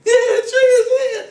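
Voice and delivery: high loud cheer with a laugh. Retold or imitated. Parents cheering as the toddler sees them, high loud laughing cheer.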